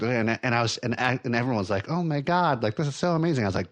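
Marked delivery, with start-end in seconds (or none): 1.9-3.4 high-pitched starstruck gush; mock gushing. Everyone fawning over the name-dropper, mock gushing. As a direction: imitate a crowd swooning, pitched well up.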